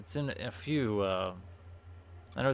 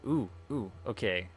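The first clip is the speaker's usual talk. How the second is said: ordinary delivery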